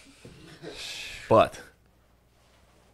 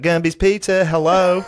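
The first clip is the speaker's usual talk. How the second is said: high, loud Southern drawl; Zach Galifianakis southern accent; 10 semitones higher, 7 dB louder than usual. Acted. Pizza manager picking up the phone, hammed-up Southern accent. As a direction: answer the phone in a piercing Southern voice. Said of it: "Zach Galifianakis do his southern accent".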